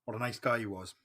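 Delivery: ordinary delivery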